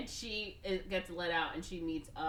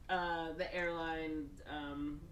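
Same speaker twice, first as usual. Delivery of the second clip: ordinary delivery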